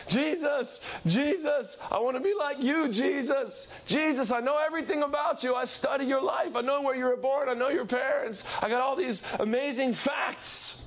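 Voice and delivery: high-pitched eager calling to Jesus; gushing fan. Retold or imitated. Believer calling to Jesus like a crazed fan, high. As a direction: call out like a starstruck fan. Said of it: "You standing at the side of the field saying".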